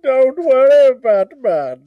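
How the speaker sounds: hammed-up Bane voice, loud swoops; swooping theatrical villain impression; Tom Hardy. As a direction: play a comic masked villain, drawn out. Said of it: "Tom Hardy".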